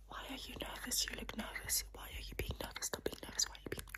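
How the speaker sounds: soft, unvoiced ASMR whisper; hushed whisper. Doctor asking a nervous patient, whispered. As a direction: whisper it close to the ear.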